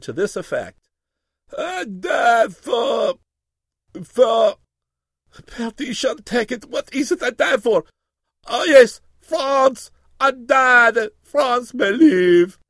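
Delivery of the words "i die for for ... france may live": high, loud, slow melodramatic wail; loud, high theatrical death speech; dramatic dying cry. A narrator's reading. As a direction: wail it like a hammed-up dying hero. Wounded hero's dramatic cry.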